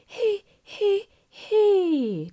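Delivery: high, swallowed back-placed he; placed too far back. Shown as a demonstration. Vocal coach showing bad back placement. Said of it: "If it's too far in the back".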